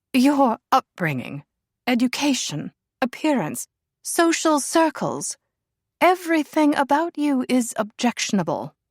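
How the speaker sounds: high, cool and disdainful royal voice; haughty princess. A narrator's reading. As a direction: look down your nose at her. Princess lists faults.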